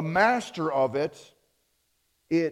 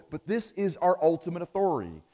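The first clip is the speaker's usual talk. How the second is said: normal talking voice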